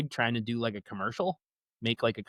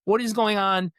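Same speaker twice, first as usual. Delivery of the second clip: angry but scared; 8 semitones higher than usual. Retold or imitated. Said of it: "He's angry, but also scared".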